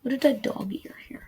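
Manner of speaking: soft whisper while flipping pages; quiet whisper to herself while searching the book; whisper to self. Real, not acted. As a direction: mutter it under your breath as you look.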